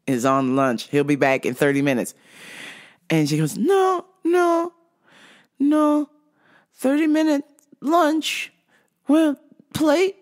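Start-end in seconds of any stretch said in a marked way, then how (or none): 3.6-10.2 high, halting confused customer. Retold or imitated. Customer asking the clerk for the vitamin man, high and confused. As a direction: speak in halting fragments, pitched up.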